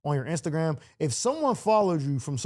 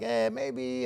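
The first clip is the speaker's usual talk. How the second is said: mock noncommittal hedge, soft and higher; evasive waffling; 5 semitones higher, 5 dB quieter than usual. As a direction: lightly imitate someone dancing around the point. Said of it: "he'll dance around it like".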